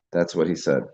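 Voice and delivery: usual speaking voice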